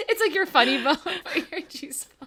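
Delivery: high giggle breaking into the words; laughing while talking. Real, not acted. Explaining own silly term to co-host, laughing. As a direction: squeeze the words out through real laughter. Cracking up over own phrase.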